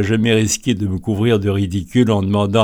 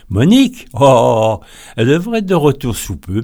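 friend's voice; 4 semitones higher, 5 dB louder than usual. Retold or imitated. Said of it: "me dit-elle".

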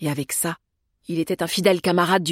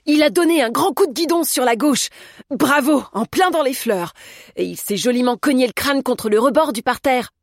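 high, loud gleeful commentary; exultant, like cheering a spectacle; jubilant; 6 semitones higher, 5 dB louder than usual. A narrator's reading. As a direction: crow over the rival's crash. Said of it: "jubila Humbert".

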